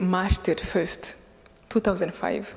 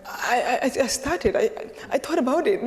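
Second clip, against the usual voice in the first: stammer, restarting on the first word; halting, higher-pitched stutter with amusement; retold tongue-tied stammer; 4 semitones higher than usual. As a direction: act out being lost for words. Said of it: "I couldn't talk".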